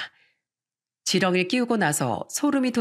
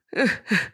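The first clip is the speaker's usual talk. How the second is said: short acted chuckle; brief laugh in a grandpa role; grandfather's chuckle; 3 semitones higher than usual. Grandfather chuckles after reassuring children.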